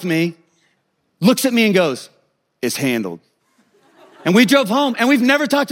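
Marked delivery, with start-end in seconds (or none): 2.6-3.2 low, understated finality; imitated cool father; no-nonsense dad. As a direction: say it low, matter closed. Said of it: "handle your business kind of guy".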